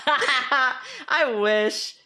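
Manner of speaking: usual speaking voice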